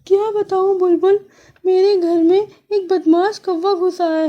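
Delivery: high sparrow voice, complaining through mock tears; tearful sparrow. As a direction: tell a friend what happened, upset. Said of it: "چڑیا روتے ہوئے بولی".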